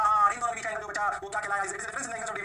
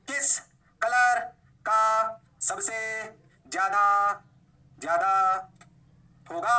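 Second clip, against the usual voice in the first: word-by-word question; 2 semitones higher, 5 dB louder, slower than usual. Shown as a demonstration. Teacher quizzing class on lateral shift, word by word. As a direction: separate words with long pauses.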